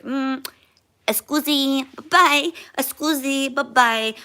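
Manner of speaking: high, sing-song shooing away of a spirit; dismissive brush-off. Retold or imitated. Telling the Ouija spirit to leave, mock polite shooing. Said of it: "I was like".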